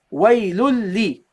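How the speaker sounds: "Waylul li" slowly, long l held into li; doubled lam. Shown as a demonstration. Teacher pronounces second lam with kasra, doubled l. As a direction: hold the l of "Waylul li" across both syllables.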